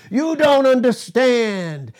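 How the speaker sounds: high, slow, drawn-out mock wail; mock protest. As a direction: stretch out an exaggerated youthful protest. Acting out complaint against Samuel.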